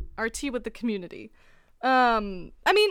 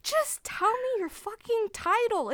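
exasperated; 12 semitones higher than usual. Retold or imitated. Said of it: "And I'm like".

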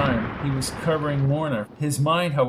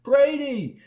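calling out; 11 semitones higher than usual. A narrator's reading. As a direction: call after him in alarm. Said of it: "cried Lorna".

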